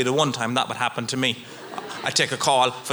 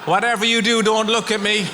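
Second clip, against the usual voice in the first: high, urgent pleading on every phrase; panicked plea; 7 semitones higher than usual. Retold or imitated. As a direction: beg them not to look, half laughing. Panicked after crashing into lady.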